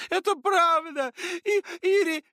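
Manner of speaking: high, loud, slow tearful cry; crying girl. A narrator's reading. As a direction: burst out crying. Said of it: "расплакалась". (Маша сквозь слёзы)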